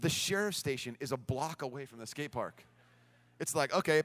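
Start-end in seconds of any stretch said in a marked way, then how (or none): none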